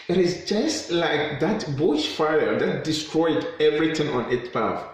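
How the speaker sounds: usual speaking voice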